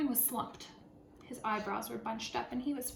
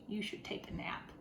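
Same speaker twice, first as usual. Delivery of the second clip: whispered advice; 3 semitones lower, 8 dB quieter than usual. Acted. Animals advising Jim.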